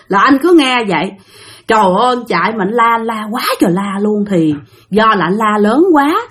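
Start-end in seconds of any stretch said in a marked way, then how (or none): none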